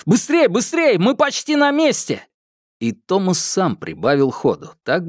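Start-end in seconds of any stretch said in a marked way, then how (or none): 0.1-2.3 urgent shouting. Acted. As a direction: drive them on at a sprint. Urging friends on while running. (торопящий крик)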